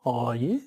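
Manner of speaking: oh yeah as a question, rising on yeah; exaggerated uptalk on yeah; rising terminal. Shown as a demonstration. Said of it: "known for the rising terminal".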